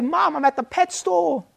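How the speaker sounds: put-on high little boy's voice calling back; lost boy telling mum where he is; child answering. Child telling mum he's at the pet store, high-voiced. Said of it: "And you say".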